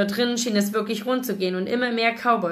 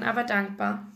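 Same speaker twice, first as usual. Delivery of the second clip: ordinary delivery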